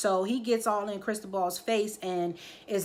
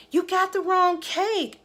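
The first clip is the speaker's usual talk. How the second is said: higher, slow mock voice of the mother; 10 semitones higher, 3 dB louder than usual. Mother at the birthday party, in a higher mocking voice. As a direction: put on a snooty mother voice.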